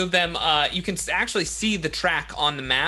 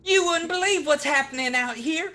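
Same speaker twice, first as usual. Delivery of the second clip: put-on excited Southern kid; 12 semitones higher than usual. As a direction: gush to mama about stardom. Voicing a Southern kid calling home.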